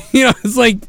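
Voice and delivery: ordinary talk, nothing marked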